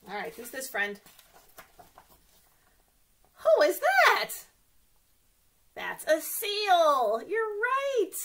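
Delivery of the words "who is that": excited question. Acted. Asks kids guessing animal.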